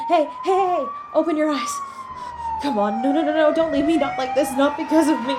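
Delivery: loud, frantic pleading to wake them; panicked calls over an injured partner; desperate pleading. Acted. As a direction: beg them to open their eyes. Trying to wake injured loved one.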